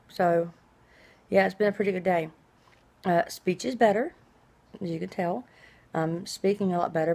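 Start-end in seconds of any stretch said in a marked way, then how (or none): none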